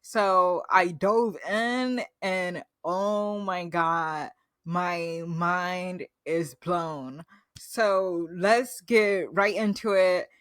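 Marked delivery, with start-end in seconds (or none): 2.8-7.2 slow, drawn-out awe; awestruck. Real, not acted. Telling viewers the show blew her mind, slow and awed. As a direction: let each word sink in, amazed. Gushing about being hooked on a show.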